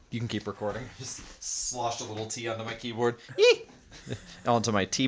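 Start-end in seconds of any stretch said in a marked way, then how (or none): 0.1-3.2 quiet. Real, not acted. Just spilled tea. 3.4-3.7 high squeal. Real, not acted. Spill reaction squeal.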